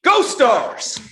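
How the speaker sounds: big loud team cheer, leaping high; shouted school-spirit sign-off; rallying cheer. Real, not acted. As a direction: build from the lead-in to a shouted cheer.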